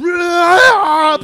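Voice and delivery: very loud high yell; battle yell. Retold or imitated. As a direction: let out a fighting yell. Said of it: "Neo's like".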